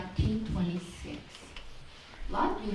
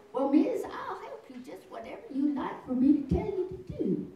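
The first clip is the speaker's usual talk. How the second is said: higher-pitched imitation of a Missouri accent; 10 semitones higher than usual. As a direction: imitate a helpful Missouri woman's Southern accent. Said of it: "'Cause she had the accent".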